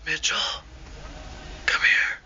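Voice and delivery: unvoiced little whispered call; faint whisper. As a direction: imitate someone whispering from across the room. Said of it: "faint little whisper".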